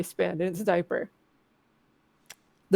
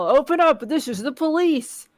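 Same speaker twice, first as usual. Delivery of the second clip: raised, higher voice, stopping short of a shout; mild narrated yell; 5 semitones higher than usual. A narrator's reading. Game narrator voices police at the door, mild yell. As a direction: call it out like a mildly acted police demand. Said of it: "You hear a man's voice yell".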